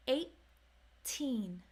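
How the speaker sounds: put-on eighteen with a stopped T before teen; held T. Shown as a demonstration. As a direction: hold the T in eighteen, then release on teen. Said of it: "you don't let the air out until".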